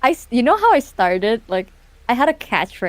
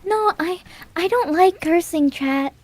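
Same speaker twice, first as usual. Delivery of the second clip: sweet innocent voice; 7 semitones higher than usual. Retold or imitated. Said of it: "I was like".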